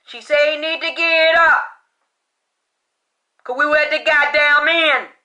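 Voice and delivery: loud, hammering shouts at the doubters; furious rant. Real, not acted. Psychic ranting at critics who called her a liar, shouting. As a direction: yell it like nobody believes you.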